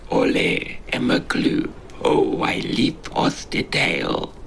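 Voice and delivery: breathy, whispery little creature; a small, timid creature trembling as it speaks. Acted. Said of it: "a trembling voice says".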